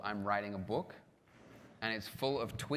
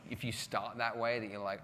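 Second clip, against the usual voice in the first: ordinary delivery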